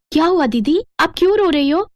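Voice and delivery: put-on high child voice, asking; a small girl worried for her sister; concerned little sister. Child asking crying elder sister what happened, high-pitched concern.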